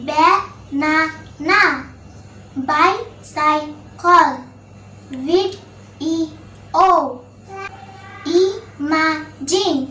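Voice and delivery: banana, bicycle, video, imagine very slowly, each in three pieces; three separated syllables. Shown as a demonstration. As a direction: pause after every syllable, as in "ba - na - na". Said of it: "যেসব ওয়ার্ডে তিনটি মাত্র syllable থাকে". (banana থেকে imagine খুব ধীরে তিন সিলেবলে ভেঙে উচ্চারণ)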